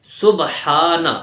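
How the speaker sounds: سبحانہ slow with a strong throat h; سبحانہ, clear heavy h; breathy h sounded. Shown as a demonstration. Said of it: "کیا بولیں گے".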